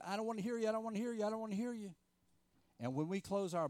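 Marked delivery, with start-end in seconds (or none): 0.0-1.9 high, petulant chant of refusal, said over and over; a child plugging his ears against a voice; stubborn refusal. Retold or imitated. Jonah refusing to hear God, high petulant chant. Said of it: "putting his fingers in his ears and saying".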